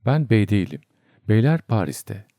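plain reading voice

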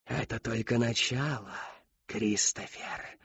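soft, slow sneer of a ghostly woman; quiet eerie smirk; smirking Whisperer. A narrator's reading. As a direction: let the words crawl out with a smirk. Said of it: "ухмыльнулась Шептунья".

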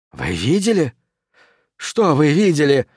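fearful, cracking questions; shaken, voice giving way; voice breaking. A narrator's reading. The terrified stranger presses Conan, voice breaking. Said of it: "голос его срывался".

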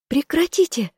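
high, piercing cry to stop the fight; a frantic high shout breaking up a brawl. A narrator's reading. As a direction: scream at them to stop. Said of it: "закричала я".

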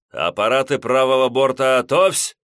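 loud order into a speaking tube; through a tube. A narrator's reading. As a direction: shout down the voice pipe. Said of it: "скомандовал в раструб переговорной трубы".

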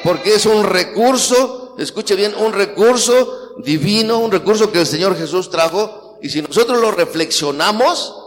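loud, high, slow pulpit preaching; preacher urging the congregation loudly; full-force preaching. Acted. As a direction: hammer each phrase to the congregation.

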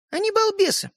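high-pitched, dismissive sister's jab. Acted. Sister explaining her brothers to Стёпа, dismissive.

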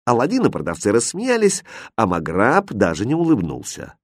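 plain reading voice

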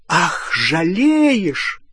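high, drawn-out derisive exclamation; slow, swooping scornful jeer; scornful mockery. A narrator's reading. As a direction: stretch the word out in bitter mockery. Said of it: "Гермиона пронзительно расхохоталась".

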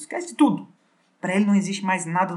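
soaring on the last word; excited. Real, not acted. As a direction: throw it up in delight. Climax of excited retelling.

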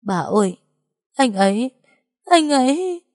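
high, choked wail building at the end; tearful sobbing. A narrator's reading. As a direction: break down like a lost child, words rising into a wail. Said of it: "tủi thân bật khóc nức nở". (nghẹn ngào khóc, giọng vút cao dần)

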